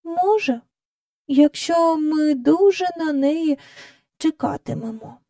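put-on slow, high girl's voice; slow, pondering. A narrator's reading. Jane answering Michael after a moment's thought, slow hopeful pondering. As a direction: draw it out as you think it over. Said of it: "повільно промовила вона".

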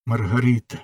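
ordinary talk

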